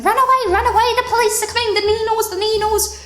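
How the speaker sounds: high, frantic crowd voice; mimicked panic. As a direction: imitate party guests raising a panicked alarm. Voicing panicked guests fleeing the police.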